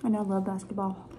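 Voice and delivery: chewing while talking; talking around food; mouth full. Real, not acted. Seen 1: Bit chip at -4; chewing visible until about 2.2s, then clear.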